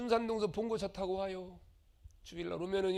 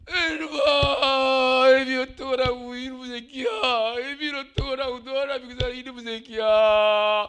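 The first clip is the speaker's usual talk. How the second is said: wailing lament; 3 semitones higher, 12 dB louder than usual. Acted. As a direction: wail over the dead son. Widow wails over dead son.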